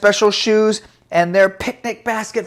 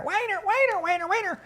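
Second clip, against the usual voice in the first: mocking whine; 14 semitones higher, 6 dB quieter, faster than usual. Storyteller mocks a complainer for children, high mocking whine. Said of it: "hear somebody complain".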